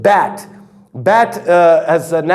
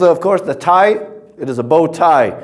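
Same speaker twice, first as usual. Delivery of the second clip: normal talking voice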